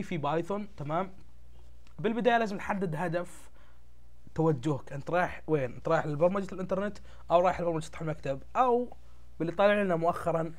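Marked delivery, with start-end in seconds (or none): none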